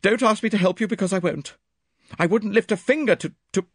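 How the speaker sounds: high, loud refusal that stalls; agitated refusal breaking off; defiant, then faltering. A narrator's reading. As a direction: protest hotly, then falter on the last word. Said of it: "she faltered".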